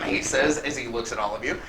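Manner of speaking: ordinary delivery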